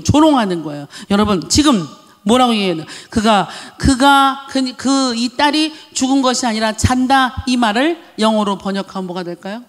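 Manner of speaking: ordinary talk, nothing marked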